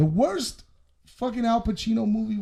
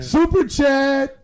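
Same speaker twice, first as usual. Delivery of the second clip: hyped announce; 6 semitones higher, 6 dB louder, slower than usual. Acted. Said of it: "We got a super chat".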